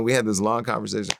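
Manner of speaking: normal talking voice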